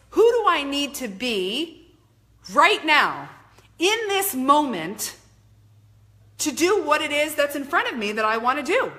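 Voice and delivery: measured, insistent motivational delivery; emphatic self-questioning. Acted. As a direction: drive each phrase home like a self-coaching mantra.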